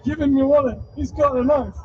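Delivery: put-on girlfriend's panic. Girlfriend urges Dundee to give up wallet. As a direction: beg him to hand it over. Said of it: "She's like".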